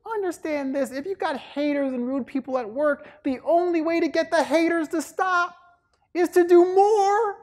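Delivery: very high mom voice, building to a loud finish; preaching mama. Retold or imitated. Voicing mother's advice, building.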